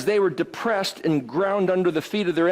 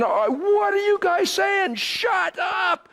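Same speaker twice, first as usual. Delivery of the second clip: crying out; 12 semitones higher, slower than usual. Retold or imitated. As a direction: shout them down in disbelief. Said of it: "started crying out".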